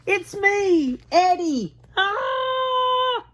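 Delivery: very high frightened cry; boy shrieking in fright; frightened scream. Acted. Eddie revealed, screams.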